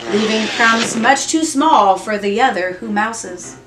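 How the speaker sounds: usual speaking voice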